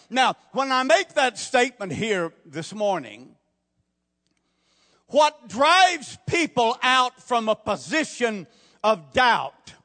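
high and loud, slowing on the key words; full-force preaching. Acted. Preacher urging the congregation, loud and drawn out. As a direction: drive the point home, drawn out.

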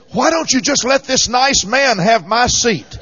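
high, slow, loud pleading call; an anxious old lady begging. Retold or imitated. Old lady begging to keep seat.

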